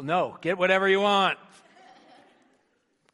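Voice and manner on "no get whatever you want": emphatic reassurance. Retold or imitated. Father insisting on ice cream.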